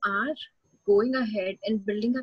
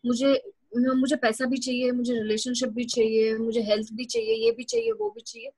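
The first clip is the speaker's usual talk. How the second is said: rapid rattled-off list; much faster than usual. Retold or imitated. Retelling her old greedy self.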